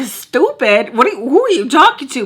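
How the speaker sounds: loud, high, fast offended boss; offended outburst. Retold or imitated. Boss offended at being called stupid, loud and high. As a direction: bark it back, offended. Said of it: "he's like".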